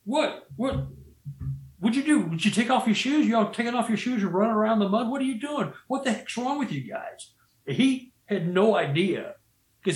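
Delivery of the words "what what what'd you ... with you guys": high, disbelieving questions; can't believe what he's seeing; baffled foreman. Retold or imitated. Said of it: "He's looking".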